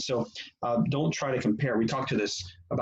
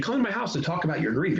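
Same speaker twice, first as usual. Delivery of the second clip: higher-pitched, pointed invitation; mock earnest, higher-pitched invitation; loaded invitation; 7 semitones higher, faster than usual. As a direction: invite them over with an obvious agenda. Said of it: "you're not saying".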